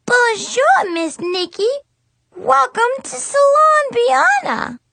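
high, loud fake French accent of a little girl; a kid hamming up a French salon hostess. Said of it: "awful fake French accent".

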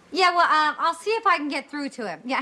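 ordinary talk, nothing marked

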